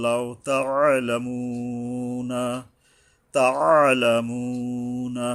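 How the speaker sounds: slow chanted তাআলামুনা, squeezed "'a", held "moo"; throaty ayn. Shown as a demonstration. Teacher contrasts ayn with alif, তাআলামুনা slowly chanted. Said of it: "এই আইন".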